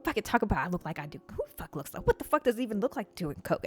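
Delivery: indignant broken grumbling under the breath; huffing to oneself. Retold or imitated. Storyteller alone after the man walks out, huffing. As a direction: grumble privately, starting and restarting in disbelief. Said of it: "I start huffing to myself".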